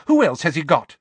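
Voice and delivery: high, loud challenge; fierce. A narrator's reading. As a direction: throw the question back fiercely. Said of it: "said Missus Weasley fiercely".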